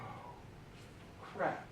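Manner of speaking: barely voiced, deflated mutter; hushed dismay. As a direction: let it sink in under your breath. Explorers find only a trickle.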